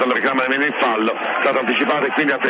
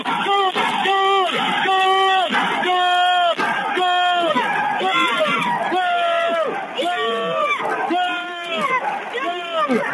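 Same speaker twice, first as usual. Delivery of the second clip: goal scream; much slower than usual. Real, not acted. Commentator celebrates a goal.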